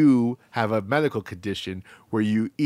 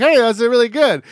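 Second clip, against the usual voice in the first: bright fake compliment; 8 semitones higher, 7 dB louder than usual. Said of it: "Amy was like".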